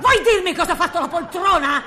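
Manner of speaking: high, fast shrieked demand; shrieking demand. Acted. Mother demanding an answer from her son, high shriek. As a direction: screech the question at him, fast. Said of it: "E c'è bisogno di strillare?".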